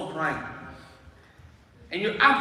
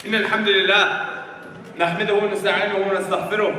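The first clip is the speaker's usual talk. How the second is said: formal Arabic opening; 2 semitones lower, much faster than usual. Acted. Preacher opening the sermon to the congregation, fast formal recitation.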